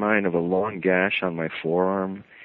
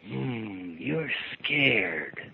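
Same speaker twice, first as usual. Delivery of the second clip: menacing; 7 dB quieter, much slower than usual. Retold or imitated. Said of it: "trying to implant fear".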